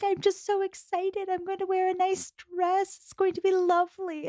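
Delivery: sweet mock-excited girl; high put-on excited Jane; gushing Jane.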